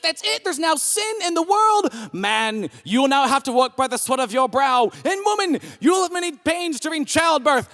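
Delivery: high-pitched, hammed-up divine outburst; angry God impression. God scolding Adam and Eve, high mock outrage. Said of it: "and then God came in".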